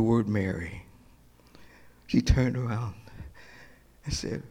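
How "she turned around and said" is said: ordinary talk, nothing marked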